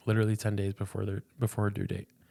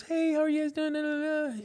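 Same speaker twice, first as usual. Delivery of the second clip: much higher, sing-song cheerful nurse voice; chirpy ultrasound lady. Retold or imitated. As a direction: put on a bubbly receptionist lilt. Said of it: "and she goes".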